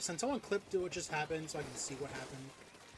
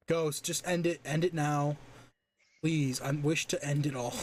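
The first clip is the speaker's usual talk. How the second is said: loud pleading; 8 dB louder than usual. Real, not acted. Begging friend to stop it.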